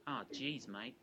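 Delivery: lightly put-on Australian accent, Queensland politician; a hint of a broad Australian drawl, half-hearted; bad Australian accent. Queensland politician to interviewer, mild Australian accent. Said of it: "apologies if I attempt a very bad Australian accent".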